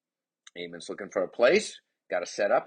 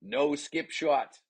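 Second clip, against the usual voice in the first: slow, higher-pitched mock objection; lightly mocked complaint from the stands; imitated disapproving onlooker; 6 semitones higher, much slower than usual. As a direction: drag it out like a protesting spectator. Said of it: "in the background going".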